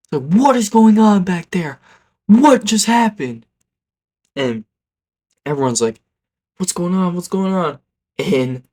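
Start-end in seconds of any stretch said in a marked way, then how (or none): 0.2-3.5 high, loud retold scream; screaming teacher. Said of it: "my teacher starts screaming at us". 6.6-7.8 high-pitched repeated questioning; alarmed bystanders. Retold or imitated. Classmates wondering in alarm.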